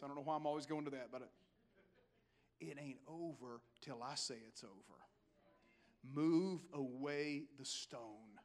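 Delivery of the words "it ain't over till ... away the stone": deep, hushed tough-guy delivery; Clint Eastwood. Acted. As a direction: say it very low, slowing on the command. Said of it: "In his best Clint Eastwood voice".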